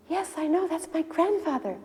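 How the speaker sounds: high-pitched eager reply; amazed recognition. Retold or imitated. King hearing his grandfather's name from Prabhupada, amazed. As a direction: answer in astonishment, voice jumping up.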